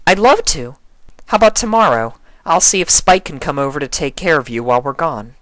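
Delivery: bright eager invitation; Twilight's eager voice. A narrator's reading.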